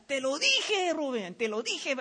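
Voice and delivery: re-enacted outburst that quickly settles; retold shouting. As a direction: call out an I-told-you-so, half shouted. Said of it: "Empecé a gritar".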